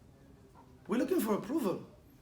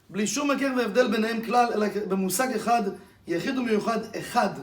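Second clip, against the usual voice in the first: fast recitation; 5 dB louder, much faster than usual. Shown as a demonstration. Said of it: "I was saying".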